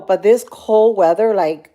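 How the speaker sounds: ordinary talk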